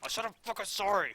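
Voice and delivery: loud, high, exasperated yell; mock angry shout. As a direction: shout the apology in mock anger. Snaps back after being scolded.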